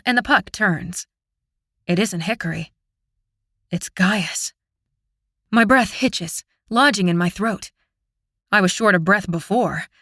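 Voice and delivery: plain reading voice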